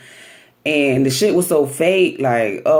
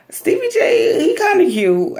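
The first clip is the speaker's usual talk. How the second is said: much higher, squealing his name; swooning squeal. Real, not acted. Host gushing over shirtless Stevie J, squealing. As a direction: gush over him like a fan.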